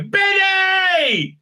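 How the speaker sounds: high, loud call across a pond; a desperate shout for a lost friend. Acted. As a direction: yell the name out to find him. Character calling out by pond.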